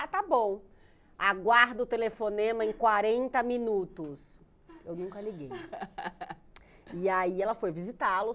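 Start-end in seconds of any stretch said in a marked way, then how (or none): 0.0-4.4 loud, mock-formal mother's reply; huffy mother. Mother answers daughter on the phone, loud and huffy. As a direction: announce it loudly like an offended mother.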